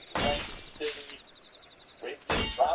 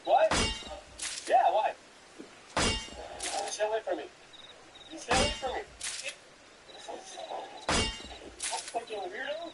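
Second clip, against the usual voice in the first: high, panicked shouts at an attacker; frantic yelling; 12 semitones higher, 4 dB quieter than usual. Real, not acted. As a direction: scream to keep the attacker off. Played clip of street attack.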